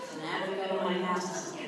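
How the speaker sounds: ordinary delivery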